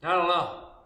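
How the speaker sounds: guess, not quite sure; a hint of an unsure child. Child guessing for the instructor, a hint of puzzlement. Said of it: "I said".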